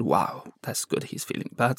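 whispered to himself, unvoiced on the second half; a private thought, barely breathed; inner thought. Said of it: "in my heart, I said".

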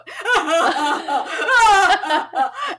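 loud, high demo of wailing sobs; imitated hysterical crying. Storyteller showing her theater meltdown, mock hysterical crying. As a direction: act out a noisy crying fit. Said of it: "hysterically crying".

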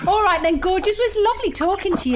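mock gushing goodbye. Mock posh goodbye to caller.

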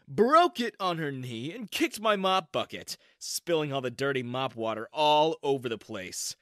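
plain reading voice

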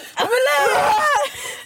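high scream breaking into laughter; laughing mock scream. Acting out his scream for a friend, laughing mock scream. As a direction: squeal out an imitated scream, cracking up.

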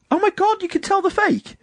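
very high-pitched put-on girly shock; mock shocked squeal. Woman told her eyelashes are fake, mimicked in shocked squeal. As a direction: imitate a girl squealing in dismay. Flattered girl learns lashes look fake.

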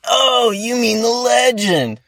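very high, slow, put-on voice of awe; awed park owner. Retelling how the park owner recognized him, in a very high, slow, awed voice. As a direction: lay the awe on thick, imitating him.

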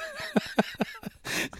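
high, airy laughing fit; real high-pitched giggling. Real, not acted. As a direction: crack up at your own story. Cracking up at his own story.

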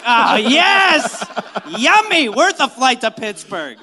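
loud, laughing delight; excited yum. Pretend. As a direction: savor it loudly. Mock guest at wedding.

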